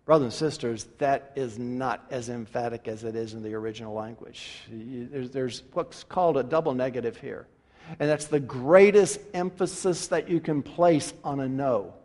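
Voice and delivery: ordinary delivery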